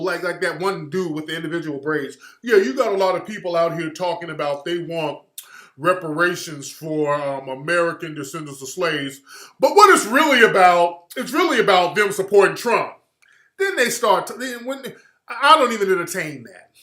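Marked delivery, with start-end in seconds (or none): 2.4-12.9 pundit impression. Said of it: "Just like when they get on Joy Reid".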